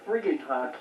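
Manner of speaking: ordinary delivery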